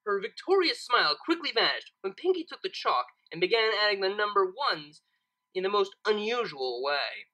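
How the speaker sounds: ordinary delivery, nothing marked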